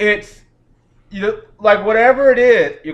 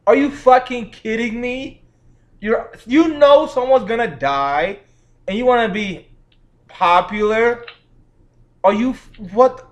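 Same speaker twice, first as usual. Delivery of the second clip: incredulous rant; 5 semitones higher than usual. Real, not acted. Ranting disbelief at character choice.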